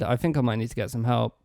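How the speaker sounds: ordinary delivery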